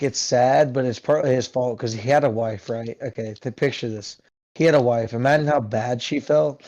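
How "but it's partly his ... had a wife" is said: normal talking voice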